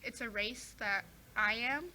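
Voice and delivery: usual speaking voice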